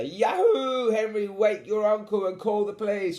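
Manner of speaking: high, loud triumphant whoop; Captain Eight Piece calling out. A narrator's reading. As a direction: holler it like a victorious pirate. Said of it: "called Captain Eight Piece".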